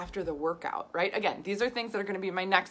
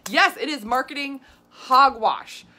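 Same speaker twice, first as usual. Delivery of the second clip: slower, still loud scorn; slow emphatic agreement; 6 semitones higher, 9 dB louder, slower than usual. Real, not acted. Vlogger confirming marketing hogwash, slow loud scorn. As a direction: drag each word out in disgust.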